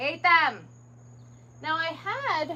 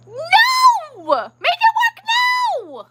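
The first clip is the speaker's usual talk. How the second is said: much higher, loud, half laughing; laughing frustrated shout; 5 dB louder, much slower than usual. Real, not acted. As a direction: yell it out in mock fury, cracking up. Shouting at the company.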